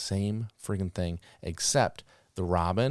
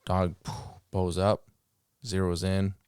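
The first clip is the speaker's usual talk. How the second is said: ordinary talk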